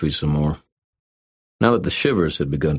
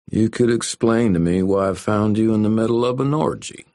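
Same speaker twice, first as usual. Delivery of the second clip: lazy country drawl, pitched up; slow rural drawl; 7 semitones higher than usual. Acted. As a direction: drawl it out like a small-town cop. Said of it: "he said in a slow rural drawl".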